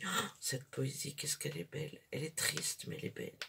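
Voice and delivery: quietly moved, almost whispered; murmured like a tender memory; soft hushed awe. Retold or imitated. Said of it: "je me suis dit". (voix douce, émue, presque chuchotée)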